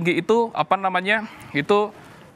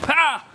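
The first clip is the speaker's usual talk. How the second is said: sharp high taekwondo kihap; sudden high "ha" on the kick; demonstrated kick shout; 8 semitones higher than usual. Shown as a demonstration. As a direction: let out a short martial-arts yell.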